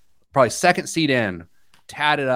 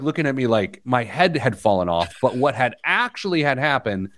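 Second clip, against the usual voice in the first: normal talking voice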